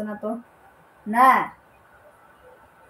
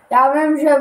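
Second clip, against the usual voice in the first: ordinary talk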